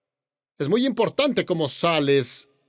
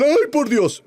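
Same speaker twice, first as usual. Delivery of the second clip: startled cry; 9 semitones higher, 4 dB louder, slower than usual. Retold or imitated.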